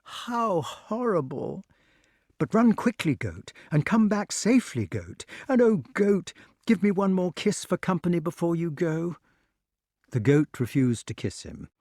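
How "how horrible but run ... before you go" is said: broken voice. A narrator's reading. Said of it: "in a broken voice".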